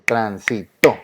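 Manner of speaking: transitó in separate syllables, TO strongest; stress on last syllable. Shown as a demonstration. Teacher repeating it for students, syllables apart, stressed TO in transitó. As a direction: break up transitó and land on to. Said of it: "escuchen bien".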